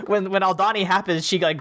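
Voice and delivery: ordinary delivery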